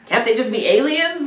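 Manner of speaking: high, pleading rhetorical question; exasperated plea, voice up high. Real, not acted.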